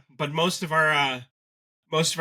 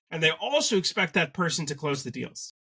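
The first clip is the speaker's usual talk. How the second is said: ordinary delivery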